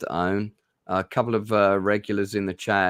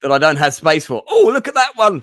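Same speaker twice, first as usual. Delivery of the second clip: loud protest leaping into a high exclamation; mock excited temptation; 8 semitones higher, 10 dB louder than usual. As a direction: act instantly tempted, much higher and louder. Mock toy addict spotting another temptation.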